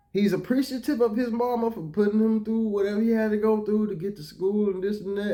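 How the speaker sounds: normal talking voice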